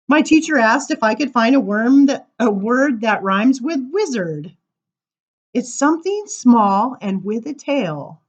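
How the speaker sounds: lively sing-song verse reading; poem reading. Acted. Reading a funny poem to the crowd, sing-song. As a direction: bounce through the rhymes for listeners. Reads rhyming poem aloud.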